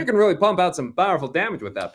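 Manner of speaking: loud, fast, high-pitched burst of enthusiasm; carried-away excited outburst; getting into it. Real, not acted. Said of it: "You're getting into it".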